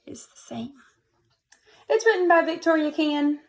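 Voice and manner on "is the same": whisper. Real, not acted. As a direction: lean in and whisper it. Unvoiced aside to children.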